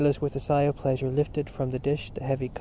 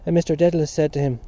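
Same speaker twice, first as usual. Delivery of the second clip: ordinary delivery, nothing marked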